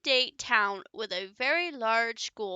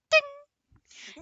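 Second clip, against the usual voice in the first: high pitched ding; 6 dB louder than usual. Acted. Sound effect in story.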